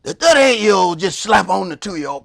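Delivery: ordinary delivery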